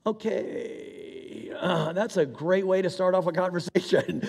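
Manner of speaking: high, airy, amused aside; wry amusement. Real, not acted. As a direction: laugh it off as you say it. Soldier doubts God, chaplain jokes.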